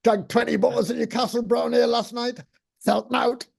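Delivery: high, chanting Geordie hard-man impression. Man imitates the hammer-bashing stage character, high Geordie boast. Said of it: "invented this character called the Hard".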